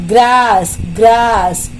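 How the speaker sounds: Grass as one long beat; grass isolated, said with a falling tone; one-syllable demo. Shown as a demonstration. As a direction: draw out the single vowel in grass. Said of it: "one syllable".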